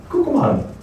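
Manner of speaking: high-pitched Hessian quick phrase; imitated Hessian. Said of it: "they say".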